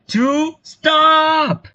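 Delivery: high, loud cry to halt; urgent shout. Acted. Halting a hanging mid-countdown, urgent shout. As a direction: yell it out to stop everything.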